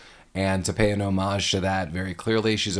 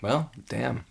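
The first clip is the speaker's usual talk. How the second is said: soft damn; 3 semitones higher, 6 dB quieter than usual. Pretend. Demonstrating escalating damns.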